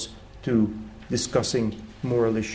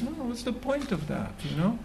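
higher, softer lazy fisherman voice; fisherman shrugging off advice; 10 semitones higher, 8 dB quieter than usual. Retold or imitated. Fisherman answering the German tourist, higher and softer, unbothered. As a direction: toss it off like a man in no hurry.

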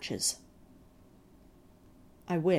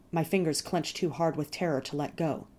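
ordinary delivery, nothing marked

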